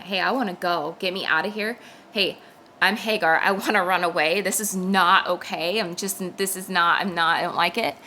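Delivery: loud, pleading cry to God; distressed plea. Retold or imitated. Praying to the Lord, begging to run away, loud plea.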